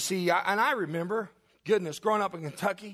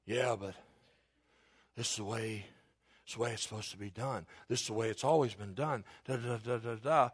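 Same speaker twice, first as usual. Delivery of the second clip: low, soft droning objection; mock traditionalist grumbling; 8 semitones lower, 6 dB quieter than usual. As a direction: mimic stuffy rule-keepers, low and muted. Mimicking grumbling Pharisees' objection.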